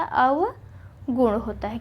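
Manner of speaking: अवगुण with a long clear अ; correct form. Shown as a demonstration. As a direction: drag out अवगुण to show it. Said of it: "अवगुण नहीं होता".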